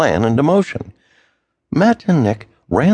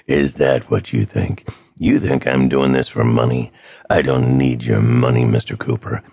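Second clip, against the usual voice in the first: gravelly villain; 8 semitones lower than usual. Acted. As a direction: menace him in a low gravel. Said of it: "The gravelly voice".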